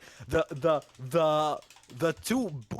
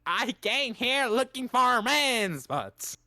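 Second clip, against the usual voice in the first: higher, slow, drawn-out mock boast; slowly announced impression, pitched up; Fleece Johnson; 6 semitones higher, slower than usual. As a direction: stretch the words out like a character's catchphrase. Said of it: "dude is a pale-skinned Fleece Johnson".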